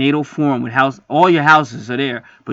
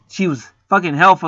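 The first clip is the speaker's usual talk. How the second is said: ordinary talk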